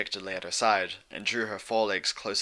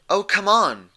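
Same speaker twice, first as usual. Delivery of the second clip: much higher and louder, exasperated; cried out; 11 semitones higher, 9 dB louder than usual. A narrator's reading. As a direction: cry it out, fed up. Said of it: "Twilight cried".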